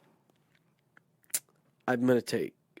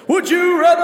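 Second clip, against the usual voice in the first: very high, loud announcer shout; game show announcer; 14 dB louder than usual. Pretend. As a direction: belt it like a show intro. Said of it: "hit me up with that again".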